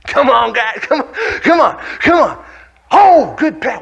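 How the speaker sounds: high, loud sideline cheering; urging on. Acted. Benched player urging on the guys, excited shouting. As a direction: yell encouragement to the team. Urging the team on while watching.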